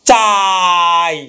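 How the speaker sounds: চাই with a very long drawn-out আ and a clipped short ই; চাই sung out with the আ held very long; stretched vowel. Shown as a demonstration. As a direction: hold the আ in চাই as long as you can, then barely touch the ই. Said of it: "আটা কিন্তু আমি টেনে নিয়ে যেতে পারছি".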